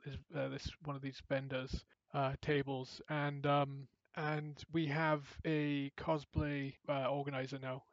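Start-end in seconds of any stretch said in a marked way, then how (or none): none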